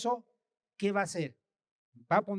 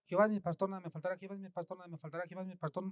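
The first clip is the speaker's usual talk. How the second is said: gabbled fast and softer, like a broken record; rattled off; 8 dB quieter, much faster than usual. Shown as a demonstration. Preacher mocking mindless repetition, fast and softer. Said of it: "no se trata nada más de decir".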